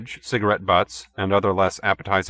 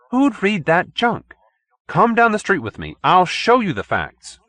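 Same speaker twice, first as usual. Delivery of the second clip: high, loud and slowed; scornful challenge; 10 semitones higher, 4 dB louder than usual. A narrator's reading. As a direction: scoff then insist, leaning on each word. Perkins challenging editor.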